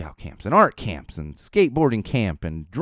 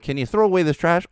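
mimicking a parent's request; 8 semitones higher, 3 dB louder than usual. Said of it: "It's like".

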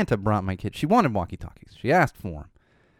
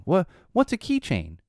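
put-on questioning kid; 10 semitones higher than usual. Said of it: "like today, for example".

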